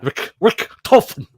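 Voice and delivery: Richthofen with an exaggerated guttural ch, loud; guttural German. Shown as a demonstration. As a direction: hack out the "ch" in Richthofen from the throat.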